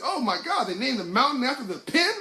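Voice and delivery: high, loud mock astonishment; amazed outburst. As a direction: exclaim like a naive tourist, half laughing. Retells his naive astonished outburst.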